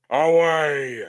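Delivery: loud captain's command; shouted order. Acted. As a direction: bellow the order. Captain orders anchors away.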